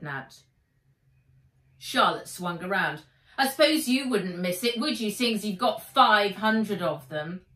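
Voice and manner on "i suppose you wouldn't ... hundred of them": louder, sneering jab; scornful girl, louder; spat out. A narrator's reading. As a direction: spit the words at her. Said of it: "The words were spat out".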